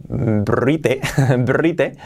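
BRITE in two Spanish syllables, "bree-teh"; spelling pronunciation. Shown as a demonstration. Teacher jokes with the written form, spelling pronunciation. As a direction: read "brite" letter by letter in Spanish.

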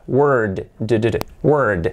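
WORD long, with the final D tapped out voiced; low, careful WORD and repeated d; voiced D demo. Shown as a demonstration. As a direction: sound the d at the end of WORD. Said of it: "por la D sonora".

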